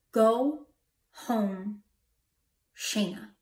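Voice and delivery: word by word, long pauses between; measured, weighty command from an unseen voice; solemn commanding voice. Retold or imitated. Unseen voice calling the stranded driver, solemn command. Retelling a voice heard from nowhere.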